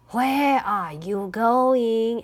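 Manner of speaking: slow, sly fox voice; slow sneaky fox asking. Acted. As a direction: draw the travellers in like a cunning fox. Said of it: "asked Foxy".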